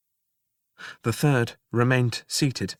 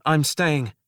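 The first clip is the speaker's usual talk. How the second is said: raised, firm I'm staying; 5 semitones higher, 5 dB louder than usual. Acted. Narrator and double telling the jailer, firm. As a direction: declare I'm staying out loud. Said aloud together.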